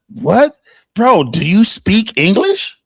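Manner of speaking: a sudden high, loud "What?", then an incredulous "do you speak English"; loud disbelief. Real, not acted. Streamer yelling in disbelief at a viewer's comment. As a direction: shout your shock at the message.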